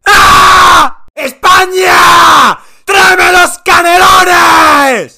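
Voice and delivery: slow, loud, stretched-out howl; crazed yelling. Acted. Uruguay revived, screaming wildly for cannelloni. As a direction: bellow it like you've lost it. Drugged-sounding wild yelling.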